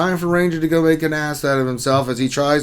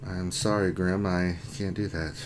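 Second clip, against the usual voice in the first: soft, low, deadpan machine voice; HAL-style refusal; 6 semitones lower, 10 dB quieter than usual. Pretend. A smart speaker answering Grim, in a soft deadpan machine voice. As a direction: refuse like a polite computer, a bit softer. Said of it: "my Alexa just got confused".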